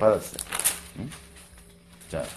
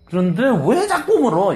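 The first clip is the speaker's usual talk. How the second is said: higher, louder irritated question; annoyed question; 9 semitones higher, 5 dB louder than usual. Acted. Blind man irritated at repeated questioning, higher. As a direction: snap back at the nagging. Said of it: "이거죠".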